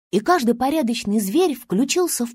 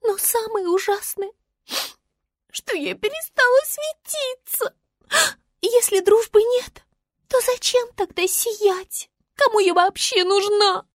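high, plaintive little voice; high-pitched tearful creature; despairing little star; 11 semitones higher than usual. Acted. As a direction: lament in a tiny high voice.

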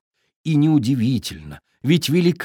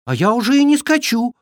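high, quick little fawn voice; a young fawn answering brightly; 10 semitones higher, faster than usual. A narrator's reading. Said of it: "отозвался оленёнок".

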